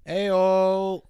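booming cheerful hey-o; loud greeting. Real, not acted. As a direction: shout a hearty hello. Guest greets loudly.

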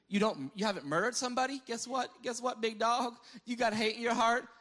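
a hint of Jesus challenging the proud; mocking challenge. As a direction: call them out, big dog. Jesus confronting the Pharisees.

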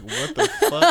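a sudden shocked squeal. Retold or imitated. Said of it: "And I was like".

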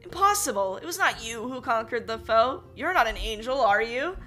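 high, loud disbelieving outburst; bewildered cry. Acted. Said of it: "cried the king in great bewilderment".

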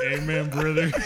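put-on country southerner; mock southern accent. Joining in on the southern accent joke, mock southern. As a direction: play up a southern churchgoer. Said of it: "Do a southern accent".

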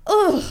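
high, loud groan of disgust; real grossed-out groan; loud "ugh" of revulsion. Real, not acted. Disgust at the creepy cops.

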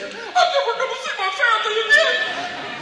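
hammed-up terror at the doorway; mock panic. Joe panicking at the door.

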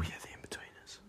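whispered aside; a quick whispered aside. Real, not acted. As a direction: breathe it under the breath.

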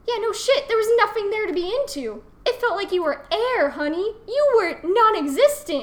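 indignant comeback. Retold or imitated.

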